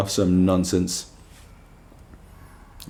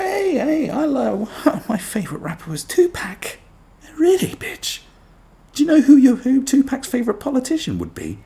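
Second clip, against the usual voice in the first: high, squeaky mock fan voice; mocking girly voice; 3 dB louder than usual. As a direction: ham up a gushing fan. Mocking woman fan, retort.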